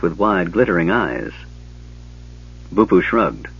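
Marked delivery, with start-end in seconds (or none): none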